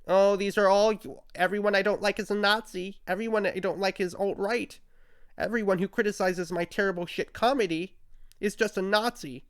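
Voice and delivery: high, sneering voice of a self-pitying rival; mocking whine. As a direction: parody him crying that everyone's a Nazi.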